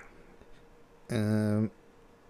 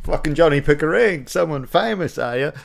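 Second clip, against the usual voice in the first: louder, scoffing imitation of the biker; a hint of the jeering biker, louder; mocking biker taunt; 6 semitones higher, 6 dB louder than usual. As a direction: sneer it like the biker in the clip. Quotes the biker after the clip.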